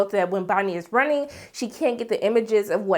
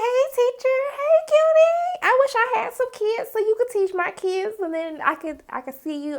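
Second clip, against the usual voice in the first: mock someone cooing at a cute teacher; flirty mimicry; 11 semitones higher than usual. Imitating Renata flirting with the teacher.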